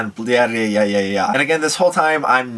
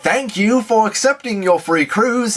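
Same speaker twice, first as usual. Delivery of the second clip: a lightly put-on Australian accent, pitched higher; mock Australian accent; 11 semitones higher than usual. Cruise scam operator answering the call, mock Australian accent. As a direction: imitate a chipper Australian phone operator. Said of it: "he's got this Australian accent".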